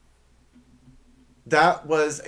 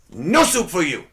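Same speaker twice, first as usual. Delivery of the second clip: put-on loud, higher Soup Nazi accent; 5 semitones higher, 5 dB louder than usual. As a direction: ban them curtly in a mock Soup Nazi accent. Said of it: "you had to say it in that accent".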